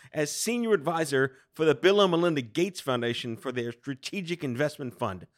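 punchy host intro with emphatic pauses; podcast intro. Acted. Host introduces prestigious guest to listeners, announcer style.

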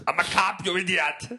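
mock Austrian action-hero accent; Schwarzenegger impression. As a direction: bark it like Arnold with an Austrian accent. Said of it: "Anybody can do Schwarzenegger".

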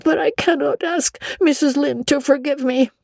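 put-on high, breathless girlish voice, overwrought; a dramatic young girl begging to be punished; Anne, dramatic girl. A narrator's reading. Anne begging Marilla, high and dramatic.

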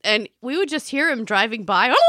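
normal talking voice

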